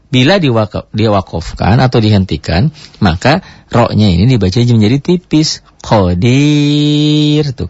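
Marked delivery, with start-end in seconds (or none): 5.9-7.5 "Qadir" drawn out slowly, final ro thin when stopping; thin ro at a stop. Shown as a demonstration. Teacher slowly modelling the pause reading of "Qadir" for students. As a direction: stretch "Qadir" and end on a light thin ro. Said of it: "Kalau dihentikan".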